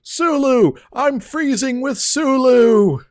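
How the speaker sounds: high, loud, drawn-out hammed-up cry; dramatic shout. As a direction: belt it like a stranded crewman. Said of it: "I have to do this at some point".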